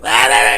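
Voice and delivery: high, loud frantic yelps; panicked yelps. Retold or imitated. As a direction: yelp like a fleeing madman. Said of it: "And he's like".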